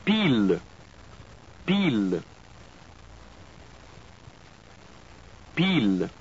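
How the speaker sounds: "Pile" as peel, pure i, no glide; French pronunciation. Shown as a demonstration. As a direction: model "Pile" clearly. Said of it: "followed by the French word twice".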